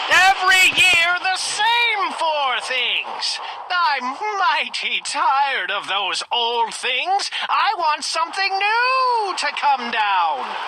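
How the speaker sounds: loud, high, slow royal bellow; bellowing king. Acted. King demands something new from the sky, bellowing slowly. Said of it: "bellowed the king".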